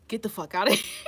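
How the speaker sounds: playful send-off broken by a laugh; laughing mock dismissal. Reader jokingly dismissing a contestant, laughing mock dismissal.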